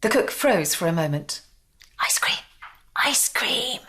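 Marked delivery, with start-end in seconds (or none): 2.0-3.8 whispered. A narrator's reading. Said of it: "whispered the smallest guest".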